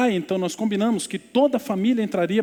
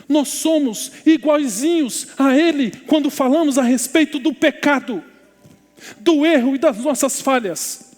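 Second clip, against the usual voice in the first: high, loud, emphatic sermon delivery; full-force preaching; 9 semitones higher, 6 dB louder than usual. Real, not acted. Pastor tells congregation all are sinners alike, impassioned preaching. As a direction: drive each phrase home to the congregation.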